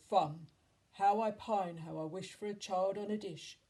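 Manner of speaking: loud, longing giant; loud storybook giant rhyme; hungry giant. A narrator's reading. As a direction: boom it out, pining for a meal. Giant longing for a child meal.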